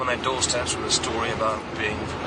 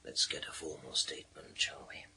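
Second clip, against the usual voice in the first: quiet whisper; 8 semitones lower, 14 dB quieter than usual. Acted. Wrapping up the interrogation.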